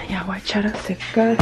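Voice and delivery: hushed whispered aside. Real, not acted. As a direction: whisper it as you get on with chores. Whispered aside while starting laundry.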